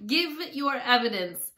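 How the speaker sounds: commanding king. A narrator's reading. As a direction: order the witness to speak. King orders a frightened witness.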